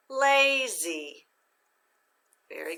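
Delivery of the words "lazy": lazy with LA high, drawn out and stressed; accent on first syllable. Shown as a demonstration. Teacher blends syllables for students, lazy accented on LA. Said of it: "put it together with the accent".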